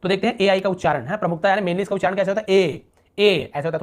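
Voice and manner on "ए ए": ए held long and steady; ए as a lengthened "ay". Shown as a demonstration. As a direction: draw out the vowel in ए. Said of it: "थोड़ा लंबा ए".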